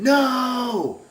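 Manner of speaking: long, high, loud cry of no; high wailing shout, held long; retold yell. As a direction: imitate a terrified man's drawn-out scream. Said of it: "I heard him yell".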